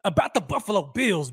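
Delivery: ordinary talk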